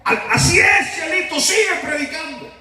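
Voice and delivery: high, strained heckler's cry; imitated high-pitched holler; drunk man's shouting. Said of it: "aquel seguía gritando. Usted sabe cómo son los borrachos".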